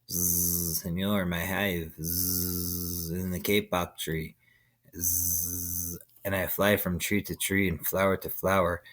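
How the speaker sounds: low soft voice with buzzing zzz; buzzing bee. A narrator's reading. Bee buzzing in the sleeping man's ear, buzzy. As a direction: hum it like a lazy bee.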